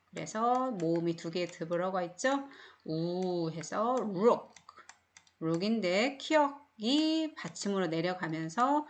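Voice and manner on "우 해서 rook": rook with a long slow oo sound; teacher drawing out the oo of rook; drawn-out oo in rook. Shown as a demonstration. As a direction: stretch the oo in rook, slowly. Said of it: "우 소리를 내주시면 돼요".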